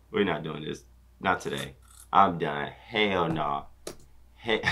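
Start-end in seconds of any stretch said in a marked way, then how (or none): none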